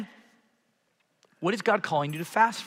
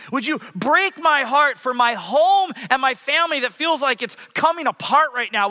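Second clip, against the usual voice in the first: higher and louder, pleading; rising fervent prayer; 5 semitones higher, 3 dB louder than usual. Shown as a demonstration. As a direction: build the prayer up with passion. Sample prayer rising in intensity.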